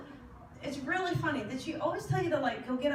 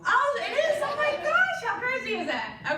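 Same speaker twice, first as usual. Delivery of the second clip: thrilled surprise; 12 semitones higher, 7 dB louder than usual. Real, not acted. Reader's hit confirmed, she's thrilled.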